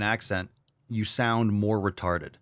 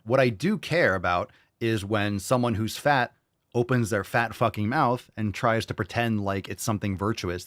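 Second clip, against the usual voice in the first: normal talking voice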